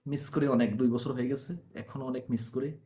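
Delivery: quiet, real sadness; subdued heartache. Real, not acted. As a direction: let the voice sink as you admit missing someone. Confesses still missing lost love.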